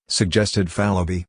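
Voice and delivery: plain reading voice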